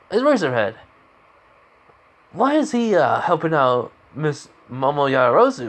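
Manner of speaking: high, baffled questioning; mock-astonished announcer; puzzled surprise. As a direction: ask in mild disbelief, pitch jumping up. Acted puzzled announcer asks colleague.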